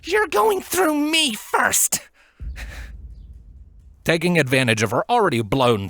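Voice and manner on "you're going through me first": high, slow, defiant mother's threat; fierce threat. Acted. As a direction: dare them to come through you. Mother defends son, threatening.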